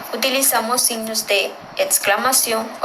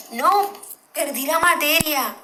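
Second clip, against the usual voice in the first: sad exclamation; 6 semitones higher than usual. Shown as a demonstration. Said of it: "o sea, de tristeza".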